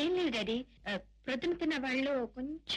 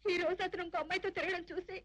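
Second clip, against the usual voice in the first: higher-pitched weeping complaint; tearful; 4 semitones higher than usual. Acted. Niece crying to uncle about the man who betrayed her, tearful. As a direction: sob it out to a trusted elder. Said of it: "ఎందుకమ్మా ఏడుస్తున్నావు".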